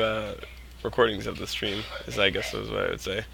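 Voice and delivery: usual speaking voice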